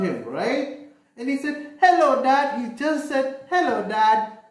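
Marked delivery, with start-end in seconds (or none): 1.8-2.6 put-on high, loud little-boy hello; cheerful child calling to his dad; little boy greeting. Bernard greets dad again. 3.5-4.4 put-on a bit higher, eager child hello; a hint of a small boy calling; boy greeting his busy father; lightly voiced little boy. Bernard greets dad again.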